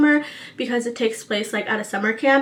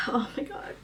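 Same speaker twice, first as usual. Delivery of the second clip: real hushed, whispered exclamation; overwhelmed whisper; 12 dB quieter than usual. Real, not acted. Reader recommending a book that moved her, overwhelmed whisper.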